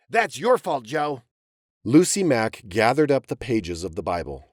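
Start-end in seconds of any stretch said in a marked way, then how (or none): 0.1-1.2 shouted back. Acted. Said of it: "Alvin shouted back".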